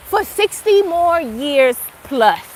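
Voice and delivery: ordinary talk, nothing marked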